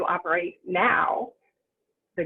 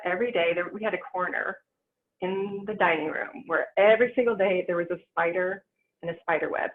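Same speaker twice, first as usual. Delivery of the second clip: ordinary delivery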